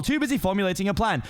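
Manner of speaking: plain reading voice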